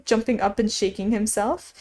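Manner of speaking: plain reading voice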